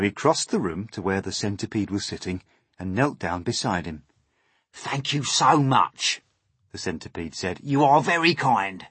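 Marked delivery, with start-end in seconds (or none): 4.7-6.2 slow, higher, loud thanks; pleased centipede. Acted. Centipede thanking James for help, pleased voice. 7.6-8.9 higher, loud centipede voice; pleased centipede. Acted. Centipede thanking James for help, pleased voice.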